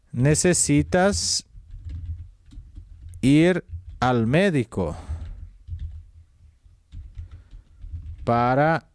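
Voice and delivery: slow, halting sentence with long pauses; slow dictation. Shown as a demonstration. Teacher dictating an example sentence slowly to students. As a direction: say it word by word while writing. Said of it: "necesitas em segunda persona".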